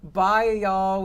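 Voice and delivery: high, loud send-off; calling goodbye. Real, not acted. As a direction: call out bye to departing friends. Calling goodbye to departing friends.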